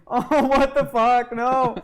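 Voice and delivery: loud, high, drawn-out disbelief; mock outrage. Child reacting to a summons, loud mock outrage.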